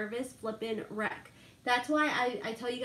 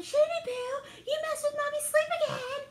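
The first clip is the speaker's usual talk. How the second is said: put-on much higher child's voice, scolding; kids scolding dad. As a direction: play the little kids telling Daddy off, pitched way up. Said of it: "they start yelling at him".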